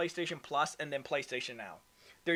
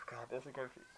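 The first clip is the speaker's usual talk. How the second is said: whispered aside; 4 semitones lower, 15 dB quieter, faster than usual. Real, not acted. Frustrated aside while explaining.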